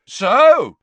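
loud, high gloating; triumphant. A narrator's reading. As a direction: crow in triumph. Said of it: "said Gaunt triumphantly".

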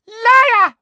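piercing, very loud high scream; furious shout. Acted. Umbridge throws Harry from her, shouting furiously. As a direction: scream the accusation at the top of your voice. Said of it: "shouted Umbridge".

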